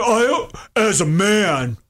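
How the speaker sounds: slow, drawn-out parody of manly protector; put-on manly voice, very slow; mocking self-important man. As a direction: play up the solemn man of the house. Mocking a man's protective rule.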